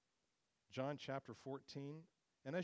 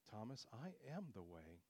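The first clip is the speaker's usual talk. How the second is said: hushed and low, almost to himself; quiet, intimate telling; 3 semitones lower, 9 dB quieter than usual. Shown as a demonstration. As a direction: say it softly, like a private word to Thomas. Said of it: "It's a verb. I, it's an action word".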